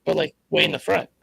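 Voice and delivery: choppy robotic mic sound; like a robot filter on the line. Real, not acted. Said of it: "You still have that robot voice".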